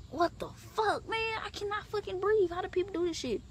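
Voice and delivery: high, strained disbelief; smothered and disbelieving; panicked complaint. Retold or imitated. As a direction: whine it out of breath. Smothered, can't breathe.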